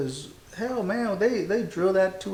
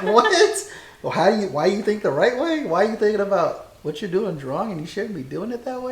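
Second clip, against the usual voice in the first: incredulous; 6 semitones higher, 4 dB louder than usual. Retold or imitated. Retold incredulous retort to civilian.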